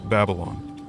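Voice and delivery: ordinary delivery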